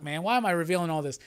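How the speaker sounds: ordinary delivery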